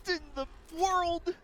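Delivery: very high, loud, slow what; stunned disbelief. Real, not acted. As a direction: shout your disbelief at the play. Caster stunned by a play.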